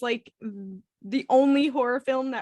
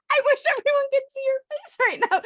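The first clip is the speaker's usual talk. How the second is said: laughing; 12 semitones higher, 3 dB louder, faster than usual. Real, not acted. Laughing at friend's horror.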